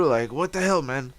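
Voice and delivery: usual speaking voice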